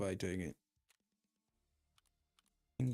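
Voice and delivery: ordinary talk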